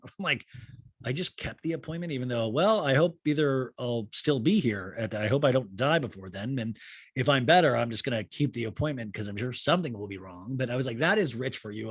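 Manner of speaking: ordinary talk, nothing marked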